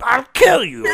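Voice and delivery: loud hammed-up threat; mock threat shout. Possessed Willie yells a threat at Dorothy. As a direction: bellow the kill line.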